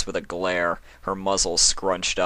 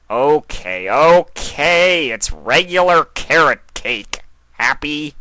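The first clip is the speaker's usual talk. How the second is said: high, loud, put-upon trickster voice; exasperated Discord; 8 semitones higher, 9 dB louder, slower than usual. Acted. Discord caving to an unamused Chrysalis, high and exasperated. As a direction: throw up your hands and give in, loudly.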